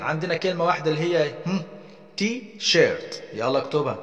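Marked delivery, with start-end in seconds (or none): 2.2-3.2 "تي شيرت" slow, in two separate beats; split into syllables. Shown as a demonstration. Teacher showing pupils two syllables, "تي شيرت" split in two. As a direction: break "تي شيرت" after تي, the first beat higher. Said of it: "two syllables، مقطعين".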